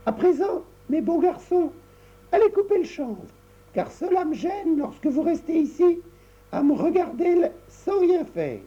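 put-on bossy little girl. Tricotta sends brothers off to work.

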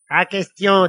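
defiant devil. A narrator's reading. Devil refusing Lily's order, defiant. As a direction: refuse loudly.

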